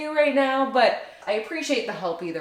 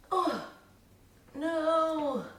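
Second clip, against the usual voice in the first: higher, quiet surprised dismay; 7 semitones higher, 6 dB quieter than usual. Real, not acted. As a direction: catch a near mishap. Cloth nearly falls apart.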